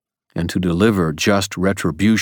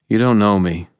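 ordinary delivery, nothing marked

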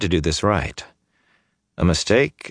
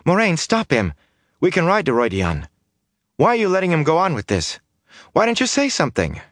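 higher, urgent protest; young woman's pitched-up pleading protest; Egwene protesting; 11 semitones higher than usual. A narrator's reading. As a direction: plead with the Aes Sedai to stop him. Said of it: "Egwene protested".

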